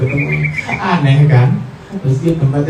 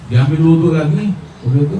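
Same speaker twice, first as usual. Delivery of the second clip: ordinary talk, nothing marked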